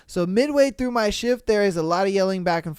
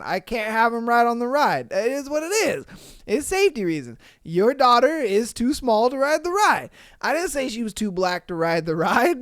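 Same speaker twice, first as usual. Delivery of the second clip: ordinary delivery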